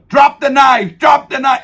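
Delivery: high, loud guard shout; guards screaming. Retold or imitated. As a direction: yell the order at the armed man. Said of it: "they're screaming".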